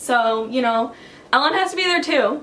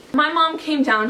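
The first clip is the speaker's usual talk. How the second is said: ordinary talk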